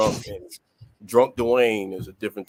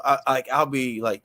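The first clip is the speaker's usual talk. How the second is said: normal talking voice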